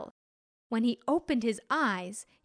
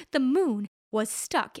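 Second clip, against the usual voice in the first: usual speaking voice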